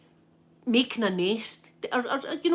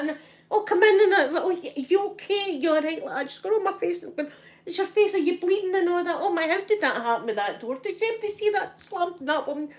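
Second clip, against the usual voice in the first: high, fussing panic; flustered concern; 9 semitones higher than usual. Retold or imitated. Mother and daughter fuss over injured old woman, flustered. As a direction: fuss anxiously over someone hurt, pitched high.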